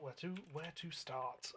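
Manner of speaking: slow, drawn-out pondering; slow musing. Real, not acted. Musing over the next job.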